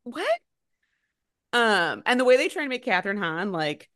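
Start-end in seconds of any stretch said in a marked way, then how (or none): 0.0-0.4 high-pitched, baffled disbelief; scoffing at it, voice pitched up; mock incredulous. Real, not acted. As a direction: climb high as if the logic is absurd. Mocking a film's makeover logic.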